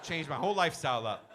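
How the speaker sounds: ordinary delivery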